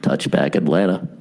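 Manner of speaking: deep mock PA announcer; stadium announcer. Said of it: "the announcer just goes".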